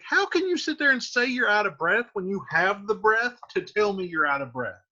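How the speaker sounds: a hint of a frustrated girl; exasperated modern Juliet. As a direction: rattle off the complaint in one breath. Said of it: "So she's like".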